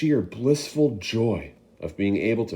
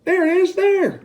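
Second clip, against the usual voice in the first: much higher, louder pointing shout; 7 dB louder than usual. Acted. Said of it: "someone else shouted".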